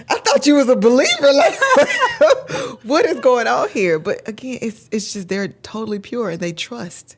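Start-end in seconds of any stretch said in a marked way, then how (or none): 0.1-4.0 high, loud, laughing imitation of a reproachful child; incredulous daughter's rebuke, through laughter; mock disrespect. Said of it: "disrespect for the rest of the day".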